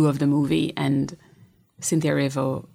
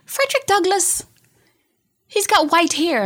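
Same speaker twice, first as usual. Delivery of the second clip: put-on much higher, louder little-girl voice; a child's piping voice, pitched way up; little daughter voice; 5 dB louder than usual. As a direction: imitate your small daughter announcing it. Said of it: "she's like".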